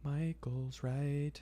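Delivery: slow drawn-out whisper. Acted. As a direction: breathe it out slowly. Repeats it whispered.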